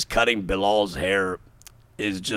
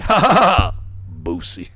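mock belly laugh cut short; barber's sudden laugh; 6 dB louder than usual. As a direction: imitate the barber cracking up, then dropping back down. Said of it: "the guy was like".

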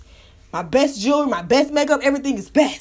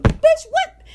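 sudden high, loud "what?"; shocked disbelief; 5 dB louder than usual. Real, not acted. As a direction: blurt it out in stunned disbelief. Confused by the show's topic switch.

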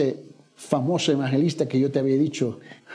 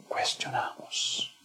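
slow, breathy whispered word; 11 dB quieter, much slower than usual. Real, not acted. Preacher confessing doubt to the congregation in a slow whisper.

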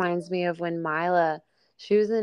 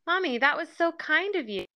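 put-on child's voice; 9 semitones higher than usual. Child quoted in mom's story.

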